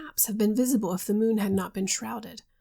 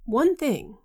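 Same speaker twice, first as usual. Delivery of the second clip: One thing pressed out higher and louder; emphatic; 4 semitones higher, 5 dB louder than usual. A narrator's reading. Husband insisting to his friends, with emphasis. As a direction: lean hard on One thing. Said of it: "he said with emphasis".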